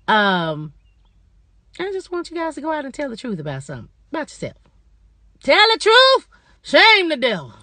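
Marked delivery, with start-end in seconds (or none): 5.4-7.5 higher and louder, a rallying cry; loud exhortation. Real, not acted. Closing her vlog, urging viewers loudly to be honest. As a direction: call it out like a preacher's punchline.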